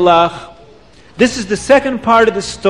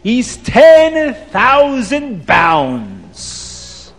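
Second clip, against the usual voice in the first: Abdallah's price call; 6 semitones higher, much slower than usual. Acted. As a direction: announce the price like a haughty seller. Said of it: "Abdallah says to everybody".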